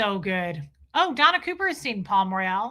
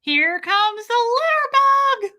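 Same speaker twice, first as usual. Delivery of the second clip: high, slow teasing sing-song; mock sing-song quote; 13 semitones higher, 7 dB louder, slower than usual. Quoting Bridesmaids to chat, high teasing sing-song. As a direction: drawl it out high and taunting.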